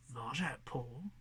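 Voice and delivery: turning the name Varjak Paw over with doubt; scornful question. Acted. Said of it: "she said".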